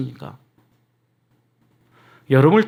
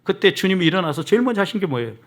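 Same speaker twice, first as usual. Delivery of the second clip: ordinary talk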